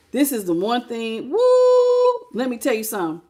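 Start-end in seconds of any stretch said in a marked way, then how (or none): 1.3-2.3 high loud whoop; excited whoop. Real, not acted. Preacher whooping with excitement mid-sermon. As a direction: let out a joyful whoop.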